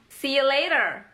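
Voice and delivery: 'See you later' as 'see ya later'; linked, casual 'See you later'; reduced you. Shown as a demonstration. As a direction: shorten 'you' to 'ya' in 'See you later'. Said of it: "kata you itu dipendekin jadi yah".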